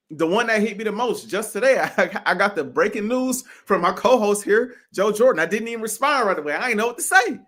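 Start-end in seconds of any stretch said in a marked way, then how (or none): none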